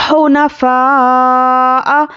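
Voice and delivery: slow, melodic tajweed recitation; measured Quranic chant. Shown as a demonstration. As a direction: chant it slowly like a qari. Recites drawn-out word.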